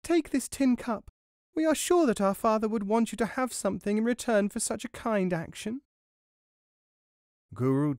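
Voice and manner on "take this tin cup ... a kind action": put-on higher children's voices, grateful and polite; dzieci z bajki. A narrator's reading. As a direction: offer thanks in a high child's voice. Children in the tale thank the mouse.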